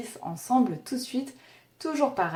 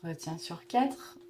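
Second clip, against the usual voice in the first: guided breathing; 11 semitones higher, 11 dB quieter than usual. Acted. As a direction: count the breaths gently, barely above a murmur. (voix douce de respiration guidée)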